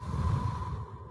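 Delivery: long, soft exhale to steady nerves; real anxious sigh; steadying breath. Real, not acted. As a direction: breathe out slowly, trying to stay calm. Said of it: "Trying to breathe through it".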